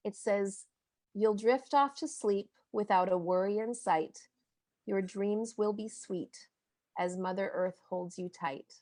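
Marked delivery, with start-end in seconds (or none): 1.1-8.7 slow, soft reading of a lullaby-like page; gentle slow picture-book reading; bedtime book reading. Shown as a demonstration. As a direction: read it like tucking a child in. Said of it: "could I read one little part of my book".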